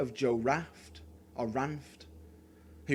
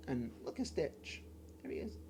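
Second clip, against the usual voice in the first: much softer, breathless whisper to a toy; soft cooing; 5 semitones higher, 12 dB quieter than usual. Real, not acted. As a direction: coo at Stitch like a pet, barely voiced. Cooing softly at a Stitch toy.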